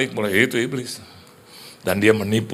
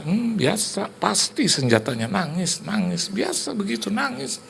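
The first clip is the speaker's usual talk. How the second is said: devil's sneer; 8 semitones higher than usual. Acted. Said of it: "iblisnya ngomong lagi sama saya".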